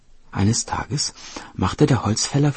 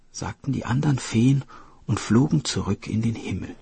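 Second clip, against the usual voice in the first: ordinary delivery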